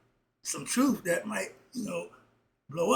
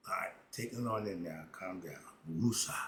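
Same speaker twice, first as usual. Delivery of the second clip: much lower and softer, settling down; calming myself down; 9 semitones lower, 9 dB quieter than usual. Real, not acted. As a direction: breathe it out to cool off. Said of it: "that's my passion".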